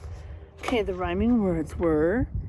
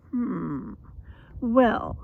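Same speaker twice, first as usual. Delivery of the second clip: usual speaking voice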